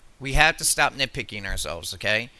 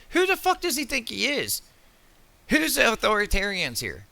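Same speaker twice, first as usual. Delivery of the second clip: much higher, incredulous angry shout; outraged outburst; 8 semitones higher than usual. Real, not acted. Ranting to viewers about the man directing traffic, outraged. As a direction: flare up at the nerve of this guy.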